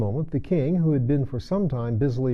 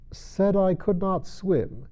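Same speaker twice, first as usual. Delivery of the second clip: slow, higher, pondering each word; slow musing reread; 9 semitones higher, slower than usual. A narrator's reading. King reading the verse to himself, slowly musing. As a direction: puzzle over the line aloud.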